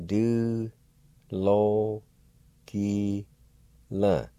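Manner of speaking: Du, lo, ki, la, each syllable said on its own with a pause between; slow spelled-out syllables Du lo ki la. Shown as a demonstration. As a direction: break Du lo ki la into four separate syllables. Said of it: "Pronounced like this".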